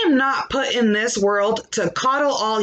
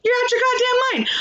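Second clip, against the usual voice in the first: exasperated outburst; 12 semitones higher, much faster than usual. Real, not acted. Ranting at critics, exasperated.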